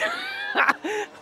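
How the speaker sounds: squeaky high-pitched laugh; a tiny chipmunk squeal of delight; helium voice. Real, not acted. Excited ride, sudden high squeal.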